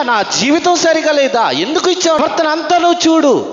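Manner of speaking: high, loud demand to God, pressing hard. Retold or imitated. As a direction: insist boldly, as if arguing with God. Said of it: "అడిగాడు గట్టిగా". (గట్టిగా, ఎత్తైన స్వరంతో దేవుణ్ణి నిలదీయడం)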